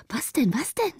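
high, urgent, frightened question; anxious questioning. A narrator's reading. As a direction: ask it twice in alarm. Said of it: "fragten sie in Angst". (ängstlich und drängend gefragt)

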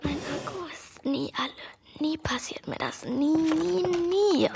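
frantic whisper. Acted. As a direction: whisper in disbelief. Hiding, battery dies.

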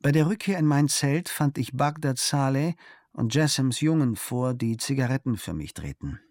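ordinary delivery, nothing marked

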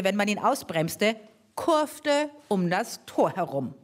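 ordinary delivery

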